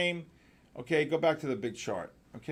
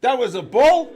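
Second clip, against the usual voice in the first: high, loud incredulous question; loudly incredulous; loud disbelief; 7 semitones higher, 13 dB louder than usual. Real, not acted. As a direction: throw it back as a challenge, rising. Disputing the sound effect.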